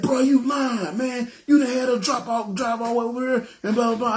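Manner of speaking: high-pitched, agitated imitation of accusing; upset disbelief. Driver accusing friend back at the car, upset. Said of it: "like, physically upset".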